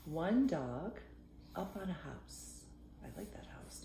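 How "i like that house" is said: soft whispered aside. Real, not acted. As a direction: lean in and whisper it. Whispered aside while reading.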